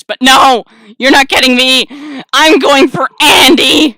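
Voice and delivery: loud, emphatic refusal; mock-indignant yelled resolve; defiant shout. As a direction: declare it at full voice. Refuses to be charmed by Yeti.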